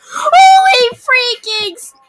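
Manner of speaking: high, slow frightened cry; panicking gamer. Real, not acted. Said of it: "I'm freaking out".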